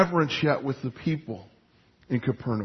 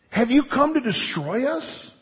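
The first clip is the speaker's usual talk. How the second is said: higher, rising demon question; demon's fearful question; 6 semitones higher than usual. Retold or imitated. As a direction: ask it like a cornered spirit. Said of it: "he cried out".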